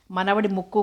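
ordinary delivery, nothing marked

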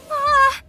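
sharp high yelp of fright; startled scream. Acted. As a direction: shriek as if ambushed. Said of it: "I yelled".

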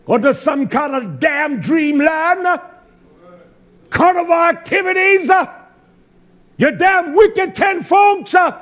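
high, loud preacher's shout; full-force preaching. Real, not acted. Preacher rebukes listeners over vacation days, full-force preaching.

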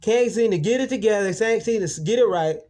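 very high, loud taunting voice; mocking quote. Imitating coworkers who mocked him, high and loud. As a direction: sneer it in a high squeal. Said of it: "And they said that".

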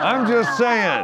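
high, slow put-on voice; drawn-out squeaky protest; mock defensive. Self-deprecating joke delivery.

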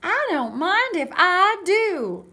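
high, loud, slow, swooping self-invitation; Goldilocks. Acted. Goldilocks helping herself to porridge, loud swooping delight. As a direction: sing it out with relish. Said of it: "said Goldilocks".

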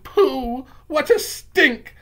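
high, loud witches crying out at a stink; high-pitched, loud complaint; disgusted cries. Acted. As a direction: wail it in revulsion. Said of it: "there were cries of".

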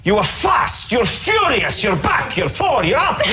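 high, excited Italian judge impression; Bruno Tognoli. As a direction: exclaim each phrase in a high, excitable Italian voice. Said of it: "I'm doing Bruno Tognoli".

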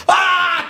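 a loud, high exhausted cry; hammed-up groaning shout; anguished yell. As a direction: let out a yell as you fall into bed. Partner yells then collapses.

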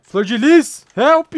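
loud, high yell for rescue; calling for help. Real, not acted. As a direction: call out urgently to your saviour. Chama a flor de lis por socorro. (grito alto de socorro)

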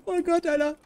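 high laughing groan. Real, not acted. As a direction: laugh at your own panic. Laughs after panic.

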